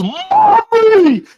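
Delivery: high, drawn-out mock wail; calling for mom. Imitating Jason calling for his mom, high wail. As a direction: mimic a hurt killer crying for his mommy. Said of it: "calls for his mom".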